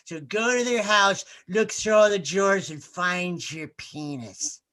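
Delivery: imitating a drunk Freudian therapist; drunken therapist. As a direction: drag the words out like a tipsy shrink. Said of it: "she goes".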